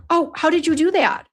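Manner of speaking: high, amazed question; a surprised high question to husband. Real, not acted. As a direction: sound amazed at the quick fix. Husband fixes mouse quickly.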